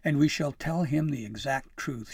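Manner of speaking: loud, firm instruction; no-nonsense, emphatic order; stern. A narrator's reading. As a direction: lay down the rule firmly. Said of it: "she said sternly".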